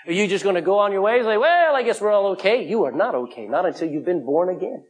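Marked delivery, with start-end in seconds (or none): 1.4-2.6 higher-pitched, casual shrug in the voice; put-on easygoing dismissal; mock complacency. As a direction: imitate someone breezily excusing themselves. Voicing a complacent listener.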